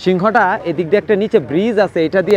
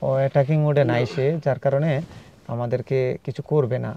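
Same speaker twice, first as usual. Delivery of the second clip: hushed reassurance; 5 semitones lower, 6 dB quieter than usual. Real, not acted. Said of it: "আমরা এখানে কথা বলা যাবে না".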